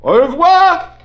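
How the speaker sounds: high, loud, chirpy au revoir. Acted. Officer hanging up the phone, chirpy and pleased. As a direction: trill the goodbye with a flourish.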